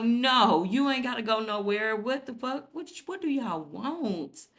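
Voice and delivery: irritated outburst. Retold or imitated. Son snapping at his parents to stop, loud and fed up. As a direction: push back, loud and fed up.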